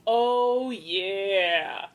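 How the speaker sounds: drawn-out, falling oh yeah; gleeful anticipation. Acted. Podcaster relishing a scam call, gleeful anticipation. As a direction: savour it, slow and knowing.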